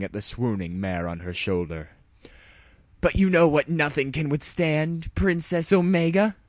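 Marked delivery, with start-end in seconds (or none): 3.0-6.3 high, loud hero challenge; heroic taunt. A narrator's reading. Dash taunting Princess Omega, high and loud. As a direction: build up to the big reveal.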